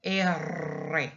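R said as "erre", tongue trilling; rolled double R. Shown as a demonstration. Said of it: "roll one that goes round".

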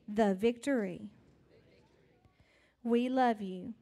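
simple prayer. Acted. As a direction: pray gently with the children. Leads children in prayer.

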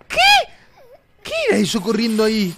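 high, loud alarmed shout; startled screaming. Real, not acted. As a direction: yell out in shock. Startled by running figure. (grito de sobresalto)

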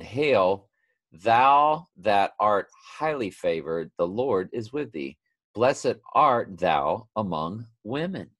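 high, slow, stirred Bible reading; emotional scripture reading. Real, not acted. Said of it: "I'm getting emotional reading this".

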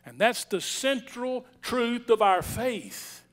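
normal talking voice

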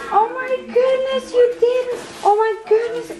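high-pitched, overwhelmed disbelief; freaking out happily. Real, not acted. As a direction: squeal it as if too thrilled to believe it. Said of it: "I'm just freaking out".

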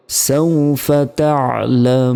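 long-held final syllable of তা'লামুন; মদ্দে আরদি. Shown as a demonstration. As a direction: recite সাউফা তা'লামুন slowly, holding "মু" three counts into a closing n. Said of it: "তিন second টেনে পড়তে হবে". (তা'লামুন-এ থেমে তিন সেকেন্ড টান)

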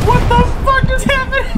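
very high, disbelieving shriek; shocked laughing. Real, not acted. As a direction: cry out in total disbelief. Shocked outburst then laughing.

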